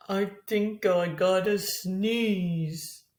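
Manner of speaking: slow, stuffy wheezing voice; wheezy hog. Acted. The hog warning he feels a sneeze coming, slow and wheezy. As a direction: drag it out like a congested hog. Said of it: "his garbled wheeze".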